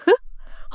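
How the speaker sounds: short breathy scoff; a dismissive little laugh; scornful laugh. Acted. Mocking Baraa's choice of art college, scornful laugh.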